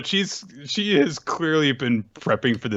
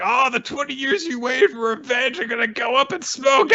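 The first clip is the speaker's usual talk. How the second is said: gloating villain shout; 12 semitones higher than usual. Retold or imitated. Orochi taunting Hiyori about her revenge, high shouting gloat. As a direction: crow over her ruin. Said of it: "It's like".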